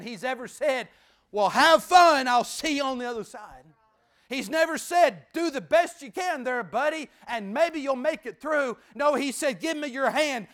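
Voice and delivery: loud, high pulpit cadence; preacher at full force; fervent preaching. Acted. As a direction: drive it home to the congregation. Preaching, voicing God's words.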